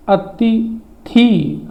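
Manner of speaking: अतिथि stretched slowly, each sound clear; slow drawn-out word. Shown as a demonstration. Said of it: "अतिथि नहीं हो". (अतिथि धीरे-धीरे खींचकर)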